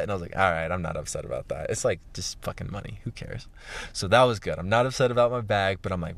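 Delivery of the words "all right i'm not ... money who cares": quiet, low shrug-off; resigned self-talk. Retold or imitated. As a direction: mutter it to yourself, letting go.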